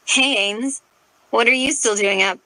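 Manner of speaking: slurred, tipsy and loose; drunken slur. A narrator's reading. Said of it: "she drunkenly slurred".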